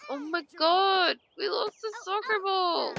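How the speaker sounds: high, whiny mock crying; mock lament. Gamer laments the lost soccer ball, high mock-tearful whine.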